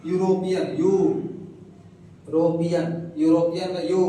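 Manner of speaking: यू of यूरोपियन rounded with the lips and stressed. Shown as a demonstration. Teacher breaks यूरोपियन apart to show students यू. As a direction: split यूरोपियन and hit यू with the lips. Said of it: "lip का सहायता ले रहा".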